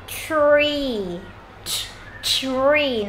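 slow "tree", CH blended into R; CH-plus-R blend. Shown as a demonstration. Teacher demonstrating a hard blend, stretched "tree". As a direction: draw out "tree" starting like "ch" with R. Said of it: "đọc kết hợp với cả âm ch và âm R".

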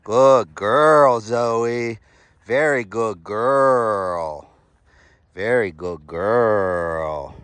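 usual speaking voice

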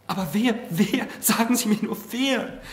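high, loud, rising insistence; urgent pleading. A narrator's reading. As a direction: beg impatiently to be told. Marquise begs again for the name.

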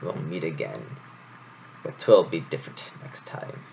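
a hint of a menacing snarl. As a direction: growl the promise low. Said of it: "pointed at the troop and snarled".

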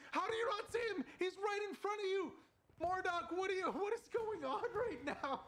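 can't believe what he's seeing; shocked disbelief. Real, not acted. Caster shocked by a missed enemy.

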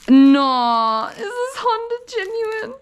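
high, teary, overwhelmed voice; almost crying with joy; overwhelmed, near tears. Real, not acted. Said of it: "I'm gonna cry".

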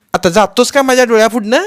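furious mother yelling, pitch climbing high; angry mother. A narrator's reading. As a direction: rage at the child and drive him out. Mother scolding the boy.

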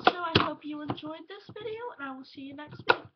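loud, upbeat sign-off to viewers; vlog outro. Acted. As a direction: wrap up the video loudly and brightly. Loud cheerful video sign-off.

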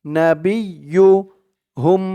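نبيهم spelled slowly, syllable by syllable. Shown as a demonstration. Teacher spelling out the word slowly. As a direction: break نبيهم into slow syllables. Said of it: "বা দিয়ে ইয়া ধরবে".

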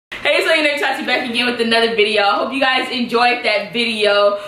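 bright fast channel outro; vlog outro. Real, not acted.

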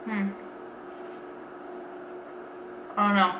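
talking between bites; chewing. Real, not acted. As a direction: mouth partly full of food. Seen 0: Bites bar at -5; lips closed and working through the labelled words.

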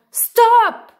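high, very loud single cry; full-voiced storybook shout; shouted halt. A narrator's reading. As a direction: shout to stop everything. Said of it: "cria-t-il".

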